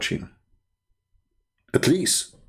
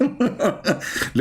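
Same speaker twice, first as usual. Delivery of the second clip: short high giggle; real laughter; 6 semitones higher than usual. Real, not acted. Streamer amused by the faith percentage, high giggle. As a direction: let out a quick high chuckle.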